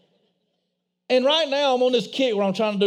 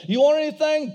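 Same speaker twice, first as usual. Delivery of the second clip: mom's voice; 6 semitones higher than usual. Retold or imitated. Mom voiced in drive-thru story.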